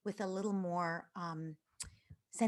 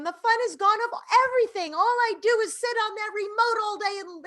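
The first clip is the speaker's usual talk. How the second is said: put-on high-pitched upset kid, swelling to a loud wail; imitated crying rant; 9 semitones higher, 8 dB louder than usual. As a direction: pour it out like an overwrought child, building at the end. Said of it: "she went on like that and she cried".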